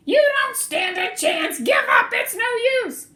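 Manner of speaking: high, loud squirrel taunting; angry taunt. Acted. Bruce taunting Cyril in the quarrel. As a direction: shout him down.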